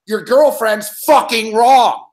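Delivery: each word hammered slowly; slow emphatic. Real, not acted. Host telling Mick his girlfriend is wrong, slow hammered stress. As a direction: spell it out word by word.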